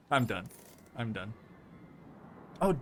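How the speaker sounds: ordinary talk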